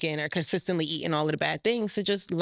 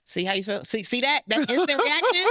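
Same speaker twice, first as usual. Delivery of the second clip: animated vindication; 9 semitones higher, 4 dB louder than usual. Real, not acted. Guest seizing on the host's instant reaction, excitedly vindicated. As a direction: voice climbing much higher and louder. Pointing out listener's shocked reaction.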